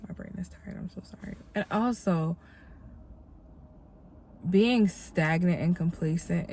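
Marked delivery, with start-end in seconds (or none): 0.1-1.4 very soft, nearly whispered apology; hushed apologetic aside. Real, not acted. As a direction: drop right down to a murmur for yourself. Apologetic aside after losing her words.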